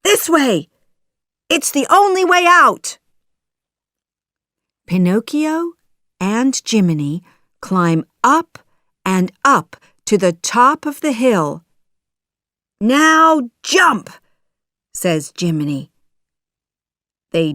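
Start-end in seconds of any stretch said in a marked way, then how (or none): 0.0-3.0 high, loud and hurried; urgent shout. A narrator's reading. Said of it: "shouts Jiminy". 12.8-14.1 high, loud call to act; urgent command. Acted. Jiminy orders the escape leap.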